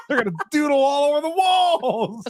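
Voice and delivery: giggling out the silly image; laughing. Real, not acted. Laughing through Doodle Warriors joke.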